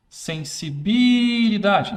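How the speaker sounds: sensibilidade stretched slow and loud; drawn-out call. Shown as a demonstration. Teacher mimics the toy calling out sensibilidade slowly. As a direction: drag sensibilidade out like the shouting doll. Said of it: "demo of the shouting toy".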